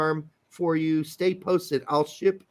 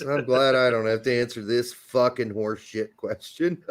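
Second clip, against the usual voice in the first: low voice, laughing through the words; mock inner voice breaking into a laugh; 8 semitones lower than usual. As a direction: chuckle while getting the line out. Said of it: "what he's saying is".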